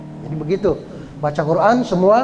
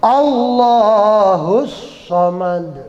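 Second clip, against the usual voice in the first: slow chanted verse; slow recitation; much slower than usual. Shown as a demonstration. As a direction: draw the words out slowly. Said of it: "Kemudian yang kedua".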